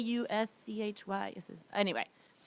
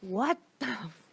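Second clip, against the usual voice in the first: stunned indignation; slower than usual. Retold or imitated. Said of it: "her voice was just like".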